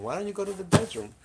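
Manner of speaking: high, loud, fast urging; urging the cat. Real, not acted.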